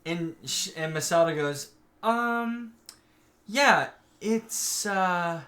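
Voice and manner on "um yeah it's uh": higher-pitched hesitant stammer, drawn out; awkward stalling. Retold or imitated. Guardian dodging who the new pilot is, awkward stalling. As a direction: stall with ums and broken starts.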